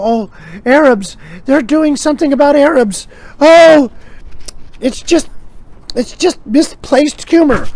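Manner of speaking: high-pitched fussy objector, drawn out; scandalised and lost for words; mock dismay. Objector upset about jokes on Arabs, mock dismay. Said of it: "somebody's gonna go".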